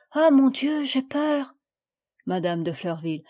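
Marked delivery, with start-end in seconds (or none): 0.1-1.5 higher, louder gasp of fear; a small girl scared of thunder; frightened child. A narrator's reading. Marguerite tells her mother of her fear, mildly frightened.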